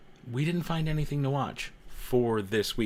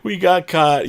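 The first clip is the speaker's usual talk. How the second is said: loud, high caught-out cry; playfully busted; mock alarm; 5 semitones higher, 8 dB louder, much slower than usual. Streamer busted by the moderator, loud and high.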